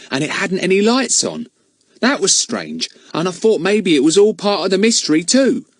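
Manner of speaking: put-on high, eager storytelling boy; Ern voice. Ern tells the children about the light, high and eager. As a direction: draw them in with your tale.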